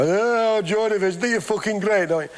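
high, far-off mock Geordie voice, taking the piss; mock Geordie accent. Newcastle band ribbing Joy Division, in a mock Geordie accent. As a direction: lampoon a Geordie fan in a squeaky put-on Geordie accent. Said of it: "I can't do a Geordie accent".

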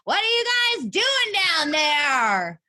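high, slow, drawn-out shout. Retold or imitated. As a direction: shout down from the deck. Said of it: "I'll shout at them from the deck".

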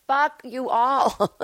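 mock exasperated brush-off; fed-up dismissal. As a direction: drawl it like you're done with everyone. Imagined bitter reply to press.

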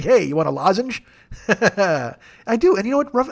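normal talking voice

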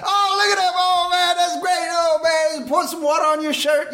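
high, excited emcee gushing; hype man. Retold or imitated. Emcee reacting to first woman, excited. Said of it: "And he's like".

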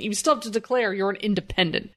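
normal talking voice

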